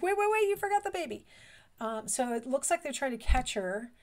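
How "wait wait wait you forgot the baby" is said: put-on high, quick little baby voice, chasing mom. Baby calling after mom in a high, fast voice. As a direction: play the baby panicking that mom left. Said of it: "They're like".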